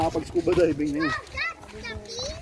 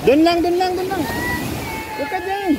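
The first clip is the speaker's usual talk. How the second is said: urgent calling out. Real, not acted. Warning the kid in the water. (nag-aalalang pagsigaw)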